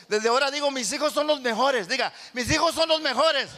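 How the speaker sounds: building proclamation from the pulpit; declaring preaching. Acted. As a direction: make the church proclaim it.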